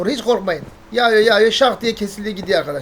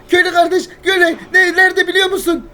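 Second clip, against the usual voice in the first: frantic pleading; 8 semitones higher, 5 dB louder than usual. Acted. Begs villagers for help.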